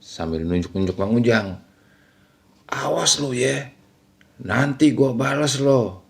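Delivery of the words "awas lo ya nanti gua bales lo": mock hysterical threat, held back in volume. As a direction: imitate the wife's eerie cry of revenge. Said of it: "lagi-lagi berteriak histeris".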